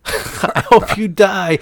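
high, slow, giggling through the line; laughing mock curse. The mock technician's last jab, dissolving in laughter. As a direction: crack up while delivering the curse. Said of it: "They're like".

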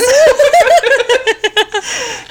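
very high burst of laughter; high cackling laugh. Real, not acted. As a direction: crack up shrieking. Laugh at ghost joke.

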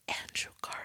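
dramatic, awestruck whisper; barely voiced, reverent reveal; hushed reveal. Real, not acted. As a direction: whisper the name like a huge secret.

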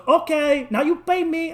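high, loud demand for payment; demanding dealer. Retold or imitated. Dealer demands money after the sample, high and insistent. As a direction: imitate a pushy Colombian dealer.

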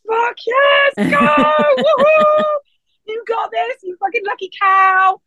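shrieking fan comments, high and loud; cheering commenters. Retold or imitated. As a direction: cheer her on like a fan club. Reading out cheering Instagram comments.

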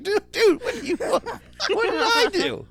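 high, squeaky protest through laughter; mock-innocent high-pitched protest; baffled Biden impression. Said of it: "Biden's like".

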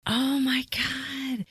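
breathy hushed oh my God; a soft stunned whisper; awed whisper. Real, not acted. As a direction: whisper it in awe. Awed reaction to first podfic.

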